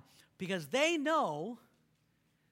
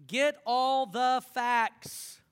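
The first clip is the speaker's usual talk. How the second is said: much louder, slow get all the facts; preacher driving home point three; emphatic slow call; 5 semitones higher, 9 dB louder, much slower than usual. Real, not acted. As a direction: belt out each word.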